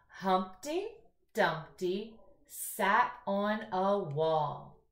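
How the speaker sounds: very slow, word by word reading; slow read-aloud. Shown as a demonstration. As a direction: drag out "Dumpty sat on a wall" for little readers.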